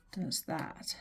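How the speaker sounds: normal talking voice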